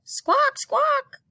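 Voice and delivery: very high, loud parrot screech; loud parrot squawk. Acted. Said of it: "squawk louder".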